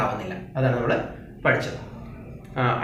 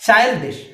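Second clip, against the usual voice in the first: Childish opened high on its first syllable; first-syllable stress; 7 semitones higher, 4 dB louder, much slower than usual. Shown as a demonstration. Teacher modelling stress, first syllable of childish high. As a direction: hit the start of childish. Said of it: "first syllable".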